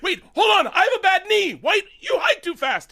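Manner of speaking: very high, loud protesting voice; mock a flustered rival yelling excuses; Jenna Schwartz. Rival candidate defending herself after the speech, high mock protest. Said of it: "Jenna Schwartz is like".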